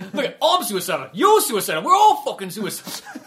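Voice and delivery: loud, rising exasperated rant; mock angry. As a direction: imitate an angry man building to an outburst. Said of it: "Just how fucking angry he is".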